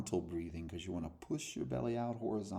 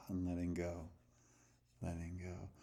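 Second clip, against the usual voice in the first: soft, fading release cue; soothing fade; guided meditation; 7 dB quieter than usual. Acted. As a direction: let the words drift down.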